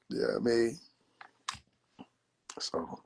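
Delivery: normal talking voice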